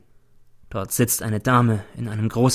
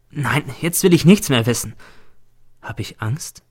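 usual speaking voice

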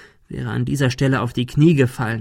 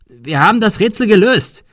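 high, loud, urgent shout; raised desperate call; 9 semitones higher, 6 dB louder than usual. A narrator's reading. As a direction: shout it across to the gunman. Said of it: "rief Thomas".

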